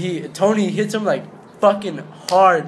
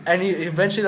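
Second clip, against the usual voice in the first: ordinary delivery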